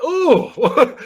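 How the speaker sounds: high, loud disgusted "oh". Retold or imitated. Retelling his shock at the old lady, disgusted. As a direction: recoil with a loud mock groan. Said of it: "He's like all disgusted".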